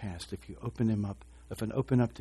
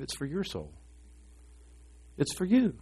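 ordinary delivery

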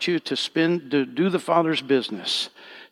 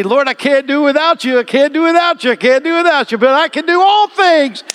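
much higher, loud crying-out prayer; preacher crying out loud to the Lord in prayer; fervent cry; 14 semitones higher, 12 dB louder than usual. Real, not acted. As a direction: cry it out to the Lord at full force. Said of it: "just say".

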